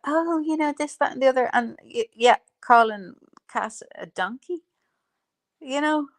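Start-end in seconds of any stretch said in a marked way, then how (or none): 0.0-1.5 mocking her preaching. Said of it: "she's pontificating about".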